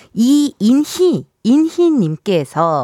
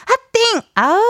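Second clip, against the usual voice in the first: excited squeal; 10 semitones higher than usual. Real, not acted. Excited reaction to pregnancy news.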